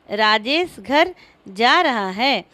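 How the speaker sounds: slow, with "जा" set off and stressed; pause, then lean on "जा" in जा रहा है. Shown as a demonstration. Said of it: "पदों पर बल देकर बारी बारी से". (धीरे, "जा" पर बलाघात)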